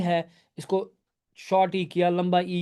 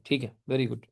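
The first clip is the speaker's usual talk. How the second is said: soft whisper "ठीक है, very good"; whispered praise; 6 semitones lower, 6 dB quieter than usual. Real, not acted. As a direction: praise a student under your breath. Teacher praising a student. (फुसफुसाकर शाबाशी)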